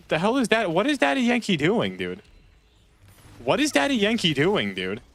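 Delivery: high-pitched, baffled exclamation; incredulous outburst. Real, not acted. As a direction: demand an explanation, voice shooting way up. Startled reaction to something in game.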